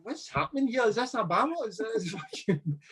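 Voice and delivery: high, disbelieving inner voice, half laughing; baffled by what's going on; bewildered. Retold or imitated. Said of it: "you're like".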